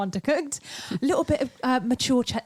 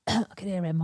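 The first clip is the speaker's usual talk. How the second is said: ordinary delivery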